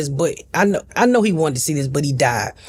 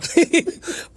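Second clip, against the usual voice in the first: a quick bright laugh, pitched up; short laugh; 10 semitones higher than usual. Real, not acted. Laughing off the interviewer's teasing, a short high laugh.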